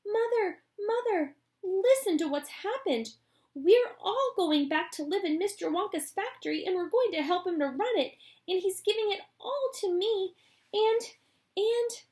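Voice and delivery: put-on high loud eager boy; tumbling over the news; breathless excitement. Charlie tells his mother the news, breathless.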